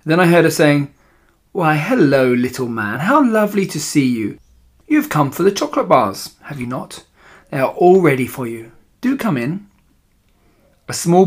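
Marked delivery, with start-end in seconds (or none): 1.5-9.7 sing-song false welcome with swooping pitch. Acted. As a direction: greet the child with syrupy politeness.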